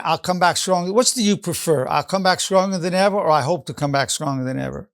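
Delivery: usual speaking voice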